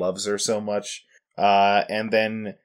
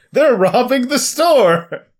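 high, loud, slow alarm yell; yelling an alarm about a robbery; mock yell; 11 semitones higher, 6 dB louder, slower than usual. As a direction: shout it like a panicked bystander. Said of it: "for a man yelling".

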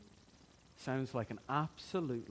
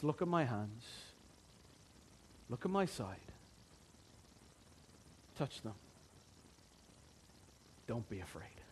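gentle risen Lord. Acted. Risen Lord speaks to disciples.

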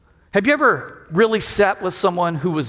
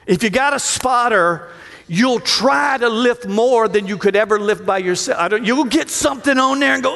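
usual speaking voice

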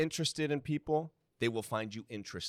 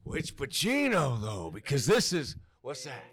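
slow, louder hint of Pacino; 2 semitones higher, 5 dB louder, slower than usual. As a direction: lean into a slow Pacino drawl. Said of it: "how about as Al Pacino?".

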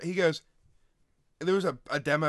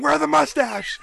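loud, high-pitched imitation of a friend yelling; hammed-up shout from the passenger; retold yelling; 13 semitones higher, 8 dB louder, slower than usual. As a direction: yell it high, mimicking your egging-on buddy. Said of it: "just kept yelling at you".

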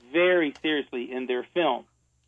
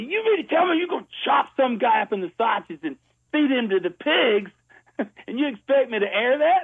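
retold screaming; 7 semitones higher than usual. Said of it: "he started screaming at me".

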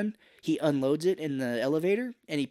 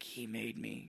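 weak dying voice; 3 semitones lower, 14 dB quieter, slower than usual. Retold or imitated. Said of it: "he was like".

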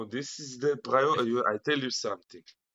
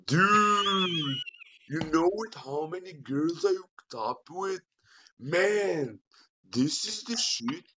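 American accent; 5 semitones higher, slower than usual. Shown as a demonstration. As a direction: ham up a party-bro American accent. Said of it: "give me your best American accent".

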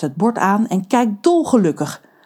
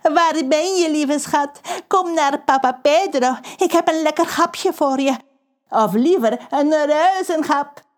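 high, sugary calling to a pet; coaxing call; 8 semitones higher than usual. Acted. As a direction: lure the shark like a darling. (lief lokkend roepen over de reling)

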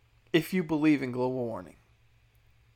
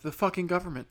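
normal talking voice

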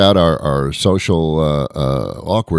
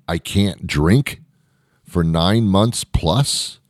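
slow disbelief; 3 semitones higher, much slower than usual. Pretend. Imagined outrage continues.

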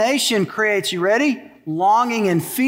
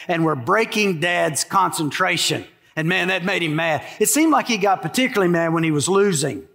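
normal talking voice

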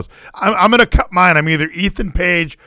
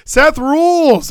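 excited cheer; 4 semitones higher than usual. Real, not acted. Excited fan declaring love.

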